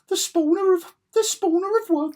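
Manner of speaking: very high, loud mock proclamation; exaggerated high pitch. Shown as a demonstration. Fan redoing the villain's title to overcompensate, high-pitched. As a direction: overshoot into a squeaky, booming announcement. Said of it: "I went up higher to".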